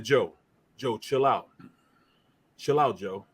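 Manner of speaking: low, measured imitated warning; retold low warning. Linebacker telling a talkative opponent to chill out, retold low warning. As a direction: drop low and warn him off.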